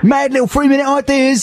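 high mocking Cockney; squeaky barrow boy voice; Cockney pop director. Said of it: "quirky ideas".

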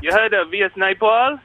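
hammed-up Trinidadian lilt; put-on Trinidadian accent. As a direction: fake a native Trinidadian accent. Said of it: "as kind of Jamaican stroke Trinidadian accents go".

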